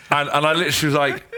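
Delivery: ordinary talk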